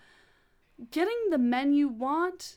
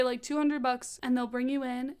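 ordinary talk, nothing marked

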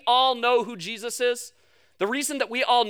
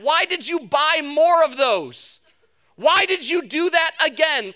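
slow, higher exasperated self-scolding; scolding yourself; 4 semitones higher, slower than usual. Retold or imitated. Person scolding themselves in the mirror, slowly exasperated. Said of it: "you look yourself in the mirror and you go".

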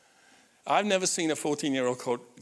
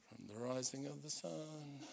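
mumbled, much softer mock sulk; sulky teen; 18 dB quieter than usual. Dad mimicking his fourteen-year-old's look, sulky mumble. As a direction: mumble it like an unimpressed teenager.

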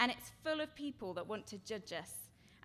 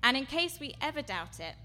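suddenly loud; 4 semitones higher, 8 dB louder than usual. Real, not acted. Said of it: "I keep going quiet and loud".

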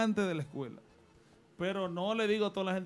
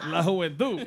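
laughing; 7 dB louder than usual. Real, not acted. Laughs while finishing the sentence.

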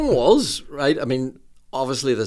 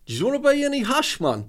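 much higher, light Geordie dealer voice; 13 semitones higher than usual. Retold or imitated. Geordie dealer at the door offering hash, light Geordie. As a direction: tempt them in a light Geordie accent. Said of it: "this Geordie guy".

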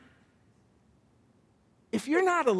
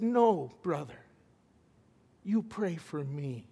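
put-on soft, low, broken quoting of a weeping old man; quietly voiced weeping superintendent; tearful plea; 6 semitones lower, 5 dB quieter than usual. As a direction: hush it as if holding back tears. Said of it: "He starts to weep".

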